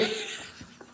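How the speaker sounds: breathy, wheezing laugh; real laugh running out of air. Real, not acted. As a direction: crack up softly. Laughs at viewer comment.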